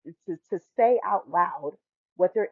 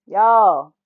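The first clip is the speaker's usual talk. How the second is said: a sudden loud y'all, cutting through; loud call; 13 dB louder than usual. Real, not acted. To the audience, building to a loud call. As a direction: call out to the whole room.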